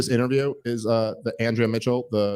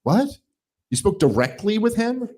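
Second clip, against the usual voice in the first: mock incredulous; 6 semitones higher than usual.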